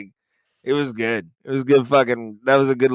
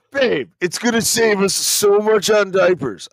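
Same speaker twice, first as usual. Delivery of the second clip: put-on cheap boyfriend voice; 7 semitones higher than usual.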